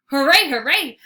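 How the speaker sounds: loud joyful cheer. A narrator's reading. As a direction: celebrate at full volume. Dog celebrating.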